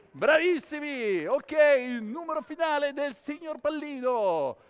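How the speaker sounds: big, high, loud announcement; show announcer. Real, not acted. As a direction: praise the crowd and announce the finale. Showman announces his final number.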